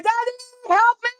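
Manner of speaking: high mock whimper of a grown man; a mock plea, pitched high; mock crying. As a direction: pretend to whine for daddy, hammed up. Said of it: "the dude was crying".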